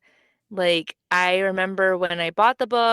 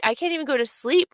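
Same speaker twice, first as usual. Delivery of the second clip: excited; 7 semitones higher, faster than usual. Retold or imitated. Quoting self, too excited to sleep.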